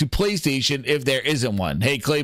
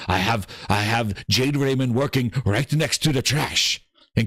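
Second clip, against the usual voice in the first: low, deep voice of a showcase host giving a tour; mock showcase host; 10 semitones lower than usual. Streamer playing the showcase host, giving a kitchen tour in a deep voice. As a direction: play the smug host showing off the kitchen.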